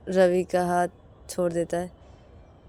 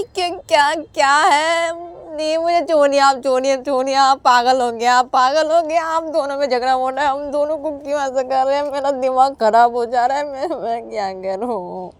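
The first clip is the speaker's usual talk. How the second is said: lower, pleading, tearful brother; desperate to be let go; Ravi begging; 6 semitones lower than usual. A narrator's reading. Ravi begs his sister to let him go to Rahul, tearful.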